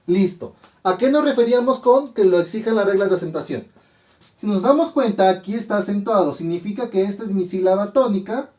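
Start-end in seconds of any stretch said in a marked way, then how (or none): none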